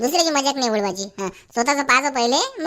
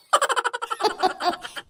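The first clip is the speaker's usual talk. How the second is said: high falling laugh; 10 semitones higher, 4 dB quieter than usual. Acted. Laughs after the threat.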